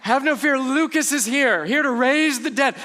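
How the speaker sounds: high, loud mock promo; hype announcement. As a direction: hype it up like a boxing introduction. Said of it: "a little promotion".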